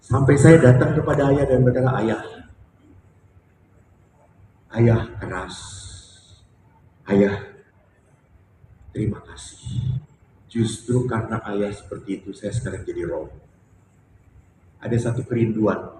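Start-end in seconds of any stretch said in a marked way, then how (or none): 2.0-13.4 tulus lembut. Retold or imitated. Berterima kasih pada ayah.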